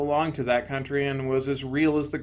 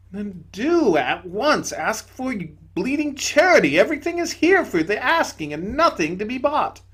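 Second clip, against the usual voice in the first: higher, gentle spirit voice; serene bright spirit; 8 semitones higher, 6 dB louder than usual. Acted. Bright spirit to the ghost, higher and gentle. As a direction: soothe him from above.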